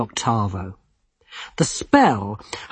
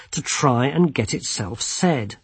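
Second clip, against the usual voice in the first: ordinary delivery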